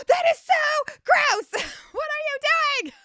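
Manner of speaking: imitating the officer yelling in disgust; mock disgusted shout. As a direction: shout it as the grossed-out cop, laughing through. Disgusted officer voiced in story.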